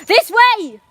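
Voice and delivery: high, very loud yelled command; real full-voiced yell. Real, not acted. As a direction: yell it sharply to get obeyed. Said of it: "And I yell a lot".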